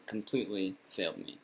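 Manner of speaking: ordinary talk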